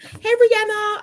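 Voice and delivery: high, long drawn-out hello; loud, high-pitched welcome; calling out greeting. Real, not acted. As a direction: sing out the greeting, stretching the name. Calling out to arriving viewer.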